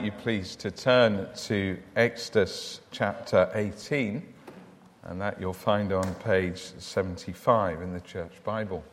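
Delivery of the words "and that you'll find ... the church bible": ordinary delivery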